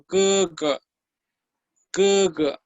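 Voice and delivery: "kuga" with Spanish-like g, high first syllable, falling second; G sound demo. Shown as a demonstration. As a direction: model "kuga" slowly, dropping after the first syllable. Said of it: "La letra G es como".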